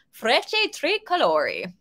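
slow, carefully drawn-out Italian pronunciation; a modest stab at an Italian accent. Shown as a demonstration. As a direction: try out the Italian name with a self-mocking Italian accent. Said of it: "My terrible Italian accent".

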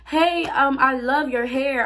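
high-pitched friendly approach; friendly compliment. Retold or imitated. Stranger complimenting her hair, high friendly voice.

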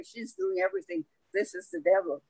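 ordinary delivery

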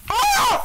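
piercing high scream at the scare; startled scream. Real, not acted. Player scared by the jump scare, piercing scream. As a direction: shriek as something jumps out.

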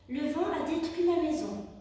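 plain reading voice

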